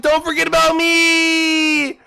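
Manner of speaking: high, loud, whiny plea from a dying car; pleading little voice. Pretend. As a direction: beg not to be forgotten, squeaky and strained. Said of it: "he's like".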